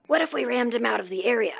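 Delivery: soft, quick aside while plotting; conspiratorial undertone. Acted. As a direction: keep it down, scheming fast. Plotting aside, afraid of being overheard.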